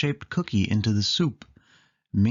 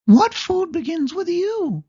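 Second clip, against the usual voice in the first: much higher, louder mouse voice; Minnie voice; 7 dB louder than usual. Acted. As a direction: ask it as a squeaky Minnie. Minnie asks a question.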